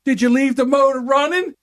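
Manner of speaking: high pointed question; dad checking, already suspecting; imitating dad. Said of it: "He goes".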